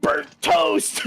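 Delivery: high, unvoiced strained cry; mock stroke victim. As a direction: gasp it out mid-stroke. Action Man having stroke.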